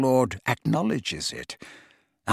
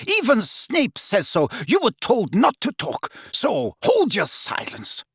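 Bellatrix, scornful; 9 semitones higher than usual. A narrator's reading. Bellatrix scolding her sister.

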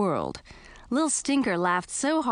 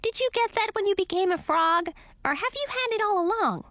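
bat voice; 14 semitones higher than usual. A narrator's reading. Bat asks.

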